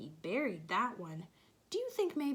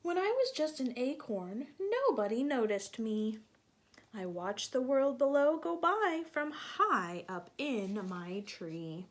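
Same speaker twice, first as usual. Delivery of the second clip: animated storybook reading; reading to children; 2 semitones higher than usual. A narrator's reading. Teacher reading aloud to children. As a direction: read it lively for little ones.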